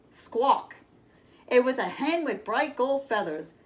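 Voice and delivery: put-on lively, bright read-aloud for kids; storybook reading. A narrator's reading. Reading Jack and the Beanstalk to children, lively storyteller voice. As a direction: tell it like a bedtime tale with big moments.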